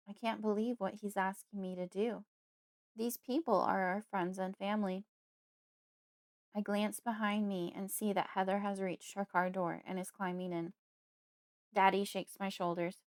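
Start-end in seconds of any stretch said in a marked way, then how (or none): none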